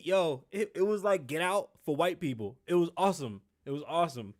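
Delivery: ordinary delivery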